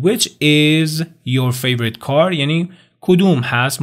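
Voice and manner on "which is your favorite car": "Which is your favorite car" very slow, rising at the end; slow model question. Shown as a demonstration. Teacher modelling the question before the video. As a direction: ask it slowly and clearly. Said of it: "اینم بگم".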